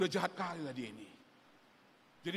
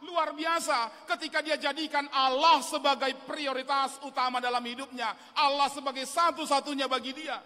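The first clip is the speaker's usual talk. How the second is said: building sermon, louder and driving; fervent preaching; 9 semitones higher, 8 dB louder than usual. Acted. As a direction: preach it with conviction. Preaching with rising force.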